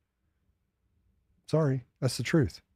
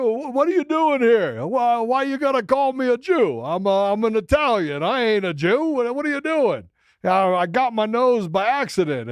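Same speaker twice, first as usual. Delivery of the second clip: Bronx Italian guy; 11 semitones higher than usual. Retold or imitated. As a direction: play an indignant Bronx Italian guy, pitched way up. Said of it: "He'd be like".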